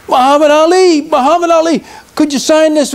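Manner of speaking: high-pitched, imitated autograph seeker; eager fan. Fans crowd the star for a signature, eager fan.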